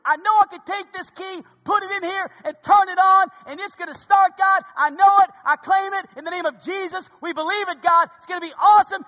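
high, loud, all-out prayer of faith; loud fervent prayer. Retold or imitated. Preacher retells praying aloud over the dead car, loudly. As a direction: claim it at the top of your voice. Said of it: "I pray a cry aloud, the guy in the gas station can hear me".

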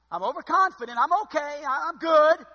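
loud, high cocky brag; smug overconfidence. Pretend. Preacher mocking an overconfident believer, loud cocky brag. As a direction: puff yourself up, brag loudly.

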